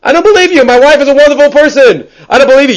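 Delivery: a hurt husband refusing to believe it, voice shooting up; indignant husband. Retold or imitated. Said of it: "He's gonna say".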